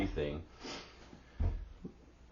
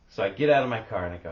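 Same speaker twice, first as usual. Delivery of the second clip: ordinary delivery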